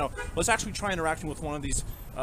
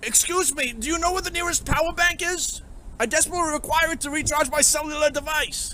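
high, courtly voice but not loud; squeaky formal NPC voice; posh game character; 14 semitones higher, 5 dB louder than usual. Acted. NPC voice repeated, less loud.

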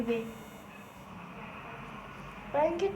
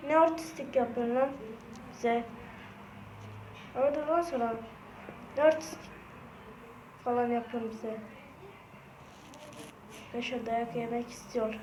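normal talking voice

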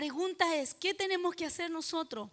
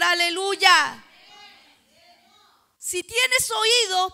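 loud, raised pulpit oratory with long-held endings; full-force preaching; 3 semitones higher, 9 dB louder than usual. Real, not acted. Preacher promising queenship to those who dine with the Lord, full-force preaching. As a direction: drive each phrase home at full voice.

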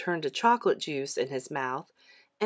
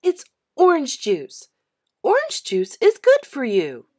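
high, loud lecture; a know-it-all kid, high and loud; bossy little girl, high and loud; prim little sister; 6 semitones higher, 8 dB louder than usual. A narrator's reading. Mary lecturing her brother.

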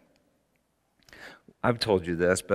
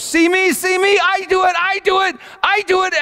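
put-on high, loud little-girl scream; screaming child; 8 dB louder than usual. Girl screaming to be seen from the slide, high desperate yell. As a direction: scream for attention at full volume. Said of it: "just screaming at the top of her lungs".

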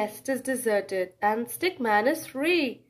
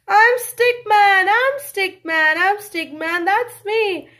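loud, higher joyful announcement; triumphant Stickman; 6 semitones higher, 5 dB louder than usual. Acted. Stickman announces he's home to his family. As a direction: burst in home, proud.

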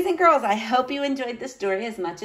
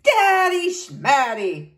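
high, drawn-out dismissive scoff; scornful mockery; 10 semitones higher, 4 dB louder, slower than usual. A narrator's reading. Knight dismissing his niece's daddy, mocking. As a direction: stretch both words mockingly.

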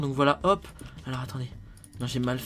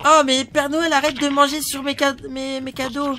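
high elf voice; 5 semitones higher than usual. Acted. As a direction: scold Santa in a high elf voice. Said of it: "une voix un peu plus aiguë".

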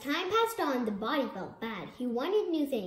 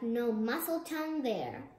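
plain reading voice

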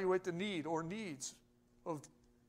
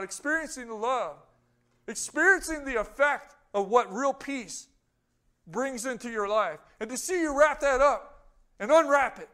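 louder, higher, driving preacher voice; full-force preaching; 7 semitones higher, 8 dB louder than usual. Acted. Preacher lifting the congregation, fervent and loud. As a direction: preach it out, building fire to the church.

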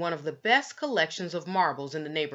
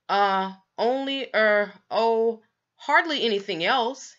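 hesitant evasive; 4 semitones higher, 5 dB louder than usual. Acted. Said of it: "he said".

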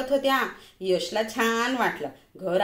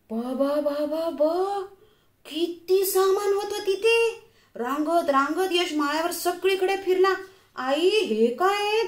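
put-on a small boy thrilled by what he finds; high child's voice full of wonder; excited child; 7 semitones higher than usual. A narrator's reading. Child exploring the loft.

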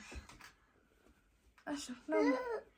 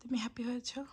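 soft unvoiced whisper; mother whispering while her son sleeps; 6 dB quieter, faster than usual. Real, not acted. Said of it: "আমরা আস্তে আস্তে কথা বলছি".

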